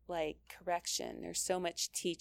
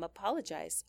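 ordinary talk

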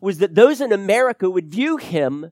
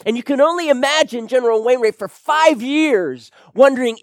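rising, louder preaching build; fervent preaching; 6 semitones higher, 6 dB louder than usual. Acted. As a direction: press the point harder with each line.

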